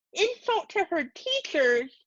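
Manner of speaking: high, slow disbelieving repeat; incredulous echo. A narrator's reading. Mother repeats father's charge in disbelief, high and slow. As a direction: throw the words back at him.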